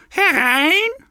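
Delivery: high squeaky granny call; old granny calling the wolf in, pitched high; old woman calling. A narrator's reading. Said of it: "da rief die Alte".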